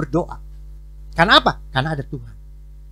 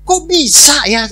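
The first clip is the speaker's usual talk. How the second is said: high, loud, drawn-out disbelief; incredulous inner reaction; 9 semitones higher, 10 dB louder, much slower than usual. Retold or imitated. Pastor privately reacting to the woman's confession, incredulous. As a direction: stretch the question out in astonishment. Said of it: "Dalam hati saya".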